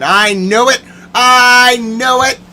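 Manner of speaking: high, loud, building yell; indignant vindication. Real, not acted. Ranting that the suspicion was right, yelling.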